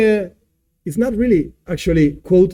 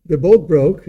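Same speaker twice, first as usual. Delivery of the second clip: ordinary talk, nothing marked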